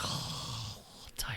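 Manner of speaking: soft whispered mock swoon; breathless fawning, hammed up; swooning admirer. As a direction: sigh it out like a smitten fan, whispering. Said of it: "women walk in and they're like".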